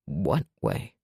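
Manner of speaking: deep, soft question; hushed and low; low quiet voice. A narrator's reading.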